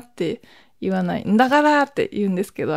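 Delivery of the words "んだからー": higher-pitched Akita dialect exclamation. Shown as a demonstration. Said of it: "秋田弁では". (秋田弁で語尾を伸ばして)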